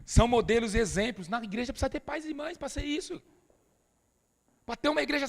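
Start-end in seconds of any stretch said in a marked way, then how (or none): none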